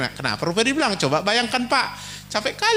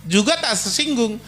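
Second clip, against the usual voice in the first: ordinary talk, nothing marked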